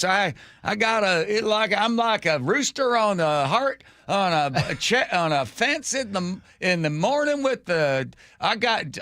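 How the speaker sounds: high, halting mock-Southern rambling; Southern accent. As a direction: fumble for folksy excuses in a Southern voice. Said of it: "You can do a Southern accent".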